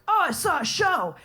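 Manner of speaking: high, bright delighted exclamation, imitated; mock excitement. Audience member leaving the show, mock excited. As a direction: gush like a thrilled audience member. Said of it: "being like".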